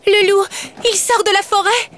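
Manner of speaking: put-on a girl shrieking in alarm at the wolf; terrified cry. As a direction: Bella crying out as the wolf emerges. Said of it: "s'écria Bella".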